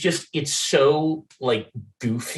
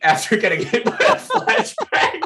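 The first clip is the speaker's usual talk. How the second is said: laughing; 10 semitones higher than usual. Real, not acted. Laughing while retelling funny line.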